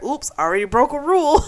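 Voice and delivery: high, quick quip breaking into a laugh; playful mock confession, laughing; amused oops. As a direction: toss it off fast and high, then laugh. Retold quip, laughing aside.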